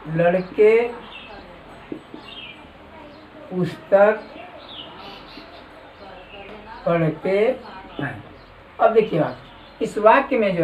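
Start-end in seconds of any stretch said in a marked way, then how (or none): 0.1-8.2 "लड़के", "पुस्तक", "पढ़ते हैं" with long pauses between; word-by-word dictation. Shown as a demonstration. Teacher dictating the next sentence for note-taking, word by word.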